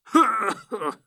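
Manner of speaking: high acted gasp of air knocked out; winded wheeze. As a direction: imitate landing hard and losing your breath. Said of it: "the air goes out of him".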